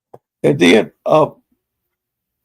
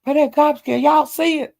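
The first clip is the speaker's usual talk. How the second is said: high, eager mock pointing; higher voice urging the stream to look; imitating Brad; 9 semitones higher than usual. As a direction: push it way up, insisting the rat is there. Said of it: "he was saying".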